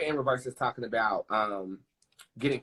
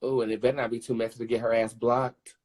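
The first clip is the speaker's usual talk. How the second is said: usual speaking voice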